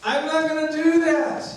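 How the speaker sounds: high, emphatic mock denial; mock protest. Someone just like Peter denying it, mock insistent.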